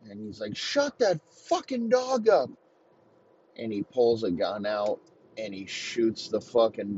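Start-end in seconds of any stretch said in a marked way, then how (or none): 0.6-2.5 high-pitched mock yell; acted angry yell. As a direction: act out snapping at a noisy dog. Acts man yelling at barking dog.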